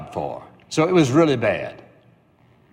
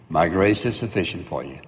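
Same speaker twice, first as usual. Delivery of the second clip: God's calm answer; 6 semitones lower than usual. Acted. God's answer voiced again.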